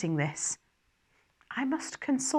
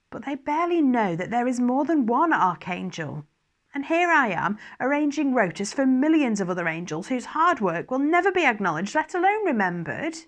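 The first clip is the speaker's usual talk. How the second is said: higher, louder, indignant outburst; aggrieved complaint; 5 semitones higher, 5 dB louder than usual. A narrator's reading. Oriel complaining to Gabriel about thankless work, indignant and raised. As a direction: let the hurt pride spill out.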